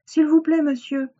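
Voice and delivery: high little-pig request; polite pleading. Acted. Pig to a man carrying bricks, high polite plea.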